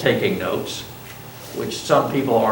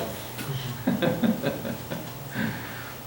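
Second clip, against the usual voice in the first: laughing; 7 semitones higher, 9 dB quieter than usual. Real, not acted. Laughs at himself.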